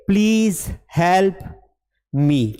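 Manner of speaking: slow, separated words with pauses. Shown as a demonstration. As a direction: model it one word at a time. Models phrase word by word. (एक-एक शब्द रुककर)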